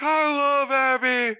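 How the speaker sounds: slow, high, doting voice; fond Terry Funk. Retold or imitated. Terry Funk gushing over his old rival, slow and high. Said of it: "He's like".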